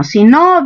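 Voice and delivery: si NO, leaning long on no; stress on NO. Shown as a demonstration. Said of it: "mayor fuerza estaría en el no".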